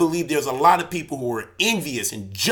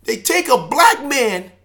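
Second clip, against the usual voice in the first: raised, loud, pleading rant; impassioned outburst; 9 semitones higher, 6 dB louder than usual. Real, not acted. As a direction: pour out a wounded anger, pitched high. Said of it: "I got emotional".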